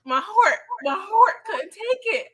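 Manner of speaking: usual speaking voice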